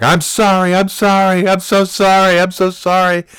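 loud, high-pitched mock panic; panicked apology. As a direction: imitate a frantic man blurting apologies. Repairman's panicked apology, retold.

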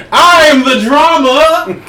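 cracking up while gloating over the drama; laughing. Real, not acted.

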